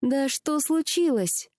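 high, drawn-out anxious demand; exasperated question, pitched high and slow; impatient. A narrator's reading. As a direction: burst out asking, unable to wait. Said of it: "не выдержала Женя".

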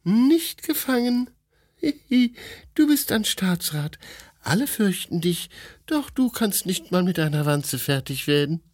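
put-on high, giggly young woman teasing; giggling wife mocking her husband; high playful wife. A narrator's reading. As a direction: tease him in a bright high girlish voice.

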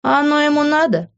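airy, indifferent question. A narrator's reading. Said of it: "равнодушно произнесла я".

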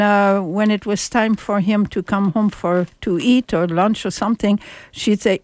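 ordinary talk

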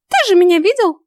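high, loud little fairy voice; squeaky fairy disbelief; astonished fairy. A narrator's reading. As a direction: protest in a piping, disbelieving voice. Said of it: "не ожидая такого поворота событий".

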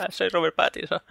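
lightly voiced relaxed dubbing actor; voz de doblador. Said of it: "le han puesto una voz como la ... del Diablo". (imitación ligera de doblador tranquilo, más suave)

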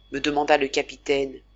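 ordinary delivery

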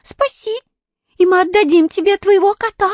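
very high squeaky bargaining; monkey squeaking an offer. A narrator's reading. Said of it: "пискнула обезьянка".

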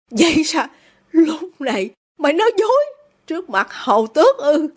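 high, challenging questions; indignant father. A narrator's reading. Father accuses daughter of lying, indignant.

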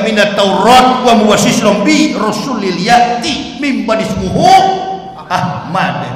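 Quran recitation. Shown as a demonstration. Preacher quoting As-Saff in measured Arabic recitation. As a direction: recite the verse with reverence.

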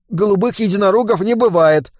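high-pitched protest; objecting boy. A narrator's reading. Boy correcting gang boss about unicorns, objecting. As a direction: object in a high, whiny kid voice. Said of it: "возразил Жига".

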